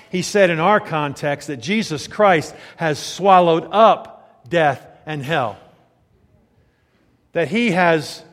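loud, slow, weighty proclamation; forceful preaching. Real, not acted. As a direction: proclaim it with pauses and heavy emphasis.

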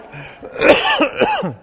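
a sharp high cough from trying to talk while inhaling; demo cough. Shown as a demonstration. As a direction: cough as if choking on the in-breath. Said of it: "Make you cough".

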